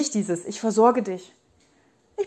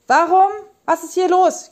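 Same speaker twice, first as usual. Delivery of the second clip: much higher, louder outraged questions; indignant outcry; 9 semitones higher, 6 dB louder than usual. Real, not acted. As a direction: throw up the questions in real indignation. (empört und laut, viel höher)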